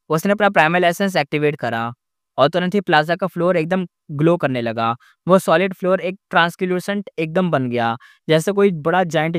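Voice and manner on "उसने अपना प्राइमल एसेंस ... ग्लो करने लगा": ordinary delivery, nothing marked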